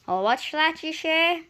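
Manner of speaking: phone voice. Acted. Fat Controller on phone.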